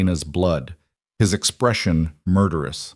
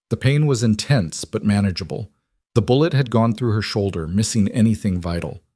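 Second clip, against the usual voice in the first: plain reading voice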